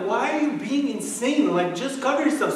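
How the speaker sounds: high-pitched, incredulous mock scolding; exasperated dad. Dad tells son to cover himself, exasperated. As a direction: plead in disbelief, pitched well up.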